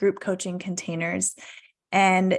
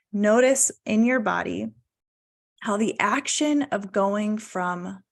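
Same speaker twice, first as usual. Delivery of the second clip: ordinary talk, nothing marked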